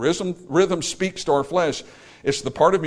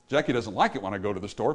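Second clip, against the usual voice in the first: fast, bouncy "Jackie doesn't like it when I go to the store"; happy rhythm; 5 semitones lower, 5 dB quieter, faster than usual. Shown as a demonstration. Preacher shows same line in a happy, fast bounce. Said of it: "but it sounds happy".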